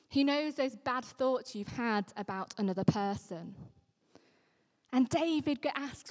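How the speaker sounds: usual speaking voice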